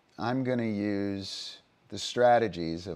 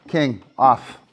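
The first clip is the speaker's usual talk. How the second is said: loud, higher command to the dog; firm command; 6 semitones higher, 10 dB louder, slower than usual. Real, not acted. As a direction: call the dog off sharply.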